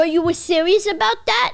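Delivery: high, loud disbelief acted out; incredulous. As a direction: ask as if caught totally off guard. His astonished reply, acted out.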